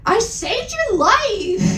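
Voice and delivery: high, slow hammed-up yell; mock angry yell. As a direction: yell it like a wounded hero, hammed up. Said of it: "he yelled angrily".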